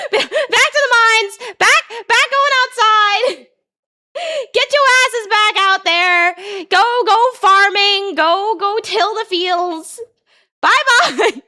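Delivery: high, slow, drawn-out calling; mock orders hollered in a high voice; mock taskmaster. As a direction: call out playfully, stretching each order. Jokingly ordering viewers back to work.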